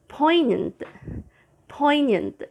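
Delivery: poignant, POIN-yunt, no g; POIN-yunt, silent g; model word. Shown as a demonstration. Teacher modelling poignant without the g. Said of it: "you say".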